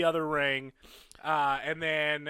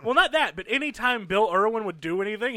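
normal talking voice

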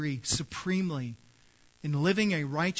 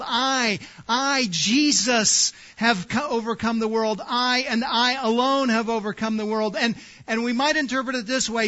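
louder, higher preaching, hammering each phrase; full-force preaching; 6 semitones higher, 7 dB louder than usual. Acted. Pastor urging his congregation to take courage, loud and high preaching. Preacher stressing Christ's victory.

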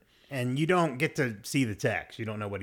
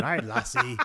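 light Scottish brogue, played for laughs; mock Scottish accent. Narrating a TV scene, mock Scottish accent. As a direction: toss off a jokey Scottish accent. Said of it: "Scottish accent".

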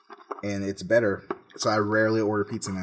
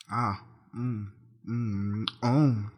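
chewing noises; 4 dB quieter, much slower than usual. Real, not acted. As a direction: mouth full of chicken. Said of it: "it's just the chewing noises".

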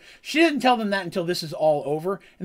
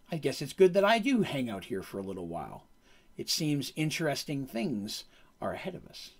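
hint at dark things ahead; softer, lower mysterious sorceress; eerie Karina voice; 4 semitones lower, 7 dB quieter than usual. Retold or imitated. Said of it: "It's like".

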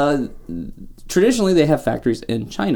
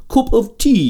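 high mock British accent; exaggerated British tea-drinker accent; bad British accent; 10 semitones higher, 4 dB louder, slower than usual. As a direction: put on a hammy British lilt, pitched up. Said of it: "tea is said by the British".

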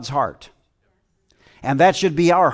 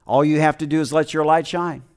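ordinary talk, nothing marked

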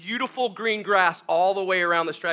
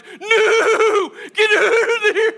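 loud, high whinnying words; speaking horse language; 13 semitones higher, 8 dB louder, much slower than usual. Acted. Hired hand tries to speak to horses, mock whinny. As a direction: neigh the words like a panicked horse. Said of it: "I'll try to speak their language".

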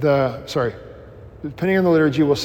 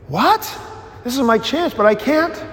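high, eager gasp of sudden hope; a blind beggar seizing his chance; blind man's excited hope; 8 semitones higher than usual. Acted. Blind man hears Jesus is coming.

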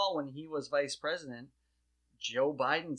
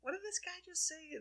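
much higher, softer, amused "What did this guy just say?"; lightness; 8 dB quieter than usual. Shown as a demonstration. Host shows the new lighthearted reaction, bemused. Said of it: "There's a, there's a lightness to it".

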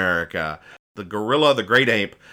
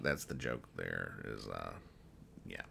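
much softer and lower, giving up; defeated mumble; 21 dB quieter than usual. Real, not acted. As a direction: trail off, beaten by the joke. Still giving up on the joke.

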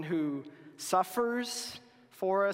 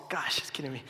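whispered aside; 5 semitones lower, 7 dB quieter, faster than usual. Real, not acted. Speaker flubbing the book's name, whispered self-mockery. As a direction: whisper under breath, exasperated at self. Muttering at own slip.

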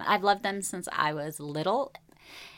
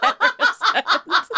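laughing; 8 dB louder, much slower than usual. Real, not acted. Laughing at her story.

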